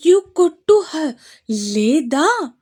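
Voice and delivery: lightly voiced little boy demanding; serious threatening toddler. A narrator's reading. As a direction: wag the words like a tiny boss. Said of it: "సీరియస్గా వీర్ వైపు చూస్తూ".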